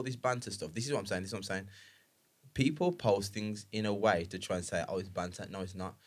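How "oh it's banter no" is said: normal talking voice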